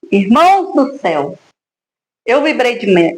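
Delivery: normal talking voice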